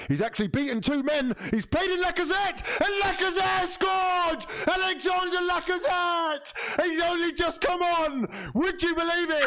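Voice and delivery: high, ecstatic commentary yell; excited goal shout. Real, not acted. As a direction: scream it like a goal just went in.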